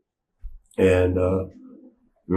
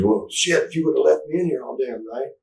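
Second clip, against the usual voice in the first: high, mock annoyed complaint; a hint of a sore, grumbling buddy; irritated friend; 7 semitones higher than usual. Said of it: "He goes".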